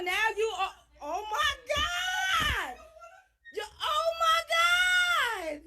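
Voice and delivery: high panicked cries; shocked screaming. Real, not acted. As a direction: scream in disbelief and fear. Woman confronted, screaming in shock.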